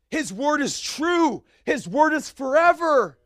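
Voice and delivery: loud, high, slow pulpit preaching; preaching at full force. Acted. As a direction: drive it home to the congregation. Preaching climax.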